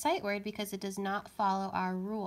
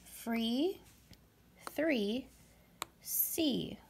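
free, three, see said slowly, each rising higher; teacher lists rhyming words; words read for listening; 3 semitones higher, much slower than usual. Shown as a demonstration. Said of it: "here are those words".

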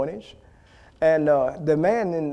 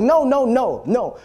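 insistent correction; 7 semitones higher, 3 dB louder, slower than usual. Retold or imitated. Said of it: "Say it with authority".

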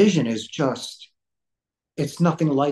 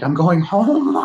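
excited; 2 semitones higher, 6 dB louder, slower than usual. Retold or imitated. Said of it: "And I was so excited".

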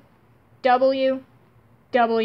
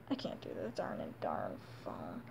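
whispered grumble; 20 dB quieter than usual. Real, not acted. Grumbling aside while drawing.

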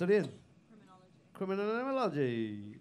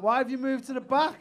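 loud, high call to the back row; 10 semitones higher, 6 dB louder than usual. Real, not acted. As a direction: shout the question across the room. Calling out to audience member.

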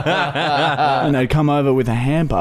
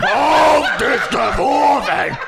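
mock German shout, laughing; 11 semitones higher, slower than usual. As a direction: shout it slowly through laughter.

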